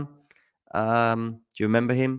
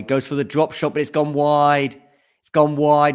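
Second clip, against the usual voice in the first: excited call; 6 semitones higher, 6 dB louder than usual. Real, not acted. Calling a missed drop shot.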